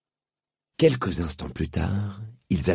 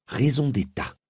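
haughty; 8 semitones higher than usual. Acted. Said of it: "répondit Polly avec morgue".

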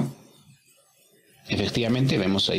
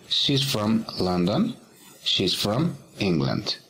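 slow model reading; slower than usual. Shown as a demonstration. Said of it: "model sentences for students".

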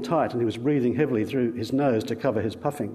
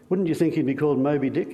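ordinary delivery, nothing marked

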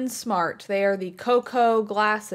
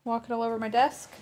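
usual speaking voice

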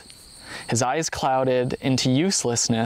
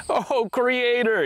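high, drawn-out cry of dismay; storyteller's contained wail of alarm; narrated yell; 10 semitones higher, slower than usual. A narrator's reading. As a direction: cry out high and slow, without full volume. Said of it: "yelled the first man".

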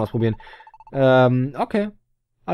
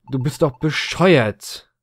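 usual speaking voice

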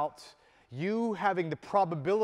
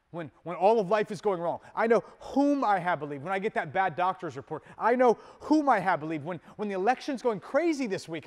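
rising, louder declarations of faith; building preacher's refrain; 5 semitones higher, 5 dB louder than usual. Acted. Preacher to congregation, building to a loud cry. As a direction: preach it with growing fire.

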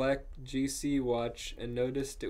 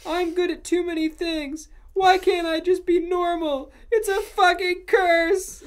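high, loud, hammed-up wail; anguished shouting; 9 dB louder than usual. A narrator's reading. Ebony cursing her many gifts, hammed-up high shout. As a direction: shout it as a melodramatic lament. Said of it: "I shouted".

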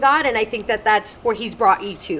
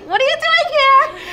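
very high, louder surprised squeal; astonished; 4 dB louder than usual. Retold or imitated. Friend seeing her unexpectedly turn the corner, astonished. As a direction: relive the shock, laughing.

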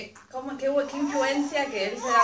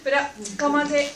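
words lost in a loud laugh; 2 semitones higher, 7 dB louder than usual. Real, not acted. Sister laughing at the child's scolding. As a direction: laugh through it.

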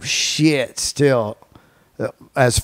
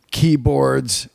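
ordinary delivery

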